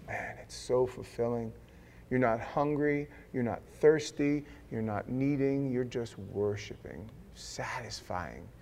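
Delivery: hushed, slow contentment; soft and content. Real, not acted. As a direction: savor every word. Savoring fulfilment.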